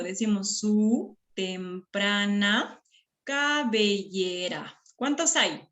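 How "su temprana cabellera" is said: very slow, every syllable separate; segmented syllable-by-syllable reading; counting syllables. Shown as a demonstration. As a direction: stretch each syllable so pupils can count. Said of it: "Seguimos contando".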